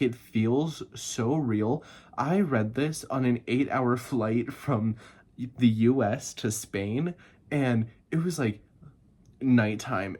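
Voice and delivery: ordinary delivery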